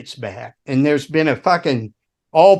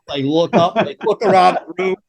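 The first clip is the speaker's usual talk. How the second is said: ordinary delivery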